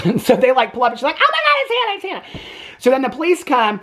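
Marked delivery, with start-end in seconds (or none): 1.2-2.4 high squealed mock shock; mock frantic cry. Retelling a mom spotting her missing daughter, mock frantic. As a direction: squeak it out in put-on panic.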